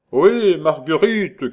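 high, loud, drawn-out call; hoarse man's shout. Acted. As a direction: call out loudly to someone in another room. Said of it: "cria la voix rauque". (cri d'une voix rauque)

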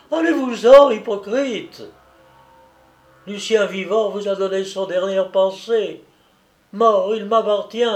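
high, loud raging cry; a mother spitting hatred at her son's wife; furious shout. A narrator's reading. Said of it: "cria d'une voix tremblante de colère". (hurlement de rage, aigu et fort)

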